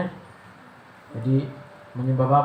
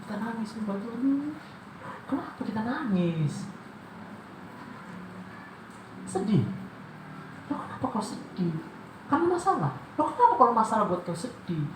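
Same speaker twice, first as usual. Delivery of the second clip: high questioning voice; 8 semitones higher than usual. Acted. Mock dialogue with crying worshipper.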